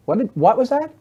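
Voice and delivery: high questioning voice; husband not catching it. Acted. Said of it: "You're in the living room".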